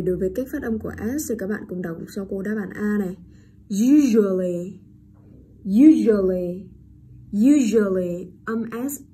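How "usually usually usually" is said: Usually loud and slow with a buzzed zh for the s; voiced zh. Shown as a demonstration. Teacher demonstrates the letter S, usually loud with voiced zh. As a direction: model "usually" loudly, voicing the S as zh. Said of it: "chữ S ở đây được đọc là âm".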